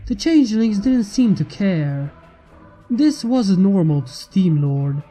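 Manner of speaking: ordinary delivery, nothing marked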